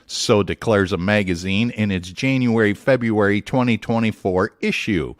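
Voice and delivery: usual speaking voice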